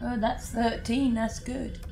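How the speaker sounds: ordinary talk, nothing marked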